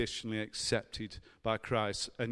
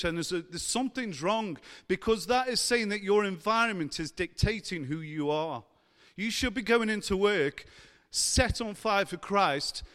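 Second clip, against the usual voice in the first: higher-pitched, impassioned preacher's exhortation; fervent preaching; 7 semitones higher, 4 dB louder than usual. Real, not acted. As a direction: preach it with urgency and conviction. Preaches with rising fervour.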